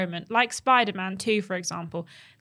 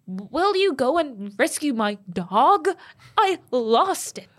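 mock AI voice; 3 semitones higher, 4 dB louder, slower than usual. Imitating bad AI voice lines.

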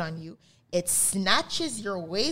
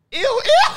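high shrieking "ew, ew"; disgusted squeal; 11 dB louder than usual. Real, not acted.

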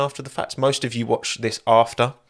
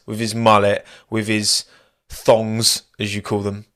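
usual speaking voice

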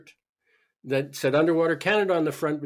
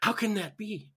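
incredulous; 7 semitones higher than usual. Retold or imitated. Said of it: "the skeptic in me going".

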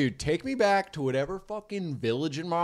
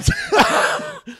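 high laugh; 7 dB louder than usual. Real, not acted. Big laugh.

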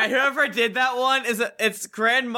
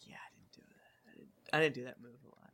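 fast, breathless whispered excuse; whispered mock excuse; 9 semitones lower, 15 dB quieter, faster than usual. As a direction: whisper it quickly, embarrassed. Mocking friend's excuse.

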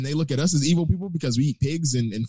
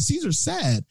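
imitating the lady; 11 semitones higher, slower than usual. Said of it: "She said".

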